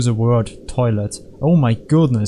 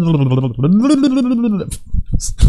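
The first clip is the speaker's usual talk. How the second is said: high-pitched gibberish babbling; mental breakdown babble; 8 semitones higher than usual. Pretend. Player losing it mid-game, mental breakdown babble. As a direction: babble nonsense, high and drawn out. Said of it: "before I had a mental breakdown".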